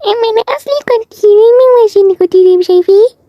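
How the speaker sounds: put-on high, affected, sing-song girly voice; provocative put-on voice. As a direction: put on an annoying, mannered voice. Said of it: "طريقة مستفزة".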